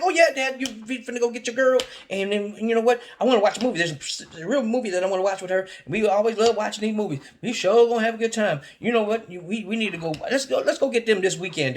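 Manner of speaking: put-on a kid begging dad for a movie night; excited kid. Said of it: "I'm like".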